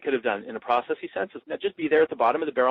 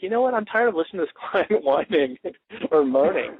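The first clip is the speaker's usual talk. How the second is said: fed-up coach; 3 semitones higher than usual. Shown as a demonstration. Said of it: "You might be like".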